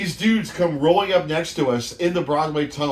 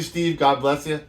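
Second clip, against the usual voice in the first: usual speaking voice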